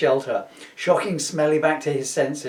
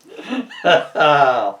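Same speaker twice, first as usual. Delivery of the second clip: loud laugh; 7 dB louder than usual. Real, not acted. Laughing at own story.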